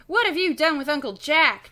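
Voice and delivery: loud, high accusing cry. A narrator's reading. Boy to the red-haired stranger about his uncle, crying out. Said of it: "Jason cries at the same time".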